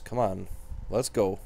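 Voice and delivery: ordinary delivery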